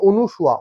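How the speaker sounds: অনুসুয়া said as o-nu-shua; common mispronunciation. Shown as a demonstration. Teacher quoting what people wrongly say, অনুসুয়া with "nu". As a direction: put "nu" in the middle of অনুসুয়া. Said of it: "এটা কিন্তু অনেকেই আমরা ভুল উচ্চারণ করি".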